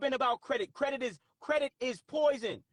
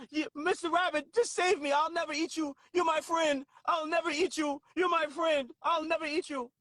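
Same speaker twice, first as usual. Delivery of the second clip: higher-pitched acted pleading; storyteller's pitched-up mock begging; pleading snake; 4 semitones higher than usual. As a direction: beg as the trapped snake, voice lifted. Trapped snake pleads with rabbit in story.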